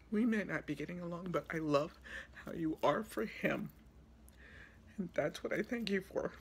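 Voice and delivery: fighting back real tears while paying tribute; choked up. Real, not acted. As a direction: push the words out through real crying. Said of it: "promised myself I wouldn't do this".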